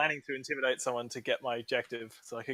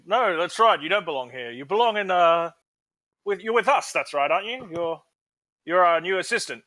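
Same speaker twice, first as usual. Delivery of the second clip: smooth persuasion; 5 semitones higher, 6 dB louder than usual. Acted. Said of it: "I'm actually gonna say".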